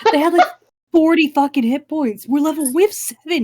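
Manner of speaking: loud, high-pitched disbelief; exasperated outburst. Real, not acted. Outraged at rats' hit points.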